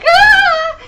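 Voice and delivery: acted scream of fright at a mouse; hammed-up scream. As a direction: let out a sudden terrified mock scream. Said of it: "And I was like".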